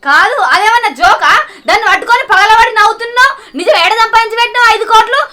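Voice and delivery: loud, bitter scolding; angry scolding. Acted. Wife scolding her drunk husband over his lies, loud. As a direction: scold him hard and fast.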